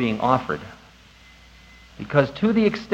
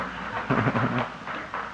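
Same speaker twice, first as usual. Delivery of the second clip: quiet chuckle; 3 semitones lower, 10 dB quieter than usual. Real, not acted. Laugh after joke.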